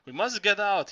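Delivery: loud, high urgent yell. A narrator's reading. Zorba yelling to the narrator in the dark, urgent yell. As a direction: drive him out of the gallery. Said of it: "he yelled".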